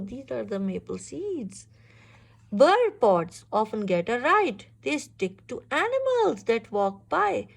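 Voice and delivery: plain reading voice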